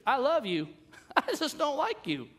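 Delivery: high, loud, sugary put-down; mock church sweetness. Church member's sweet-sour line, mock sugary. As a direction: say it sweetly, then twist the knife. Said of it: "have heard this in church?".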